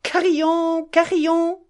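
mother calling the dog with glee. Acted. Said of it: "en appelant".

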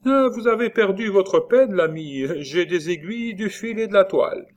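high, loud countrywoman's call; crying out. A narrator's reading. Nurse turning away the pedlar across the field, high loud call. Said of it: "elle s'écria".

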